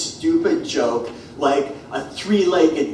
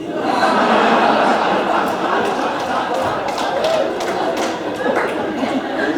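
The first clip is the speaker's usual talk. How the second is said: drawn-out breathy laugh; long unvoiced laugh; 10 semitones higher than usual. Real, not acted. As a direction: let the laugh roll on. Long laugh at own joke.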